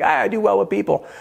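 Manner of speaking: high, breezy overconfident quip; cocky self-assurance. Retold or imitated. New salesman boasting to himself, breezy high confidence. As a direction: brush it off like you've got people handled. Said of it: "I'm like".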